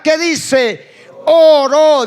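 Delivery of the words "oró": loud call. Shown as a demonstration. Preacher leading his congregation in repeating, calling out loud. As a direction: punch it out loud for the congregation to echo. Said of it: "diga conmigo".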